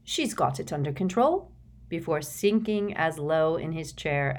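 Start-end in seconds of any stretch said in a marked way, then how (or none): none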